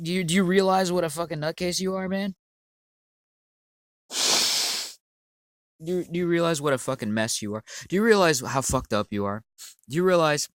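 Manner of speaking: loud, rising exasperated tirade at the commenter; angry rant. Real, not acted. Ranting YouTuber yelling at his troll commenter, loud and exasperated. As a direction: hammer each question harder.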